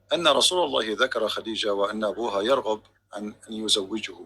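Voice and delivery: quick recitation of an Arabic hadith; reading Arabic text. Real, not acted. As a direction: rattle off the quoted Arabic passage fast. Reads Arabic hadith text aloud.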